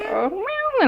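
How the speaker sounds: put-on playful meow for a toddler; cat meow. Shown as a demonstration. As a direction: meow like a kitty to teach. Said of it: "like that".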